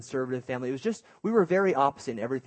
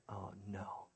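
hushed, lower mock dread; whispered uh-oh; 5 semitones lower, 19 dB quieter than usual. Two kids caught fighting by mom, whispered dread.

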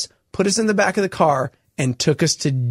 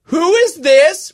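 high, slow, sharp mom on the phone; suspicious mom; 12 semitones higher, 6 dB louder, much slower than usual. Retold or imitated. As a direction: demand it like a suspicious mother. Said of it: "my mom's like".

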